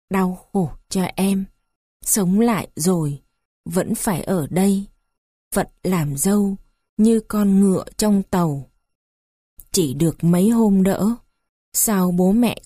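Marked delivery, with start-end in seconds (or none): none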